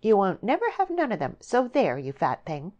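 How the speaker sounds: high, loud, snappish taunt; spiteful snap. A narrator's reading. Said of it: "with a fox-like snap of her small teeth".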